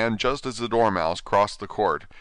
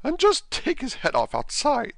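imperious queen. Acted. Queen orders a beheading.

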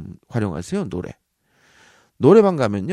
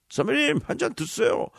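eager junior; 12 semitones higher than usual. Pretend. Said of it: "선배들은".

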